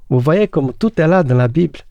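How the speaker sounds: ordinary talk, nothing marked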